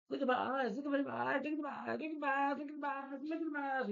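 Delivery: very high, repetitive put-on voice; mock woman's voice. Reviewer mimicking her in the make-out scene, high mock voice. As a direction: squeal it over and over, imitating.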